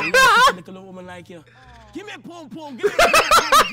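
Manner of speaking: put-on loud Jamaican accent. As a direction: yell it in Jamaican patois. Roadman act, shouted chat-up.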